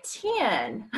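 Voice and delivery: "ten" drawled into two syllables, "tee-in"; Southern country TEN. Retold or imitated. First-grade teacher correcting a kid, drawled "ten" as "tee-in". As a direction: stretch "ten" slowly toward "tin", country style. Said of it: "you say it like".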